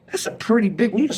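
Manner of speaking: high-pitched, uneasy understatement; mock alarm. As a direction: squeak it out while eyeing something scary. Uneasy reaction to a huge needle.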